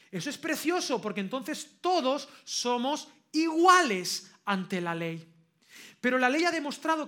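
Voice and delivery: loud, emphatic pulpit oratory; forceful preaching. Real, not acted. Predica con toda su fuerza. (predicación potente y enfática)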